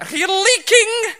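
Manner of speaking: high shrill scream, stretched slow. Retold or imitated.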